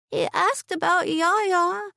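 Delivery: put-on high, slow child's voice; a tearful small girl speaking; little girl. A narrator's reading. Ember answering her aunt, high slow child voice. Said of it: "Ember said".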